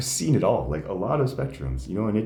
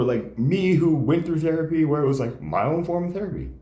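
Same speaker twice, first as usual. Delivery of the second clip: ordinary talk, nothing marked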